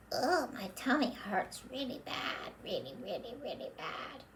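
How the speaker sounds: hammed-up tummy ache; mock whimper. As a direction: whine about the tummy, trailing off. Puppet complains softly.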